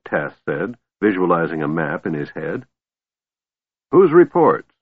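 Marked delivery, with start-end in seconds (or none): 3.9-4.7 suspicious. A narrator's reading. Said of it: "asked the dwarf suspiciously".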